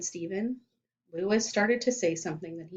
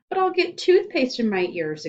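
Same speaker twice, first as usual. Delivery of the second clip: exclaiming; 6 semitones higher, 6 dB louder than usual. A narrator's reading. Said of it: "exclaimed Todd".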